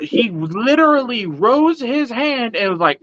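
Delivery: ordinary talk, nothing marked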